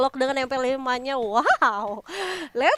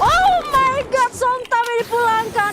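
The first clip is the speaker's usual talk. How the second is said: excited shout; 6 semitones higher than usual. Real, not acted. Caster hype on round win.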